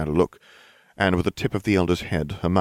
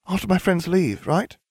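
high, wide-eyed innocent question; deceptively innocent; 12 semitones higher than usual. A narrator's reading. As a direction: ask sweetly, feigning innocence. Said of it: "eyes wide and deceptively innocent".

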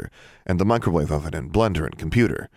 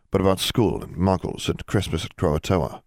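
plain reading voice

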